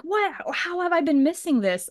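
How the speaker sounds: high-pitched amazed disbelief; blown away. Retold or imitated. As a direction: imitate a stunned new fan. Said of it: "completely blown away".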